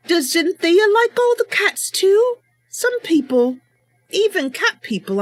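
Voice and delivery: Mercy character voice. Acted. Mercy reassuring worried Ishu, loud and confident. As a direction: reassure him with bold confidence.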